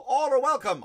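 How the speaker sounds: high, loud proclamation; mock welcome. Officials greeting all comers, mock proclamation. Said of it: "But they're like".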